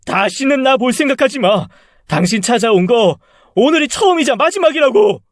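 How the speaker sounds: high, loud parting shot; furious shouting. Acted. Son cutting off his mother for good, furious shouting. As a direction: shout her out of your life, pitch soaring. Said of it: "전 엄마에게 끝까지 소리쳤습니다".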